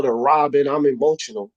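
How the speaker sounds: ordinary talk, nothing marked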